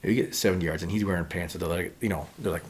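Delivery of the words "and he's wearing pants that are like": ordinary delivery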